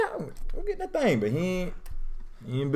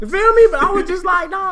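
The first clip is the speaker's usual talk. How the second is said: excited; 4 dB louder than usual. Real, not acted. Teasing with laughter.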